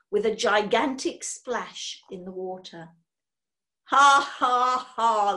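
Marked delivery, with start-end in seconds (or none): 3.9-5.3 loud, spelt-out mocking laugh; jeering ha-ha, first one punched hard; gloating laugh. A narrator's reading. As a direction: laugh at someone else's trouble. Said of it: "laughed Ticker".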